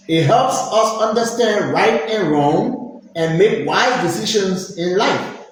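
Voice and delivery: ordinary talk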